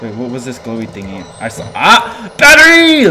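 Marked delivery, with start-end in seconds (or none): 1.7-3.1 very high, loud excited yell; over-excited shout. Real, not acted. Player spots a battery in the game, excited shout. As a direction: shriek it at a lucky find. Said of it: "I get too excited".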